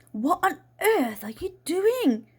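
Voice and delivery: slow, high, swooping disbelief; scandalised aunt's high-pitched outburst; aghast. Acted. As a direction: demand it in drawn-out astonishment. Aunt's shocked question at the chaos.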